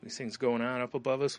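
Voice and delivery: normal talking voice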